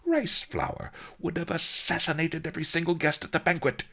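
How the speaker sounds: fast, high, indignant retort; scandalised old master, pitch up; angry. A narrator's reading. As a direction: rattle it off in exasperation. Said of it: "Master Li said angrily".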